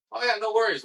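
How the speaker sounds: high, breezy mock reassurance; a hint of a chirpy producer. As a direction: play the producers as falsely cheery.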